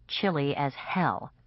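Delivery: ordinary delivery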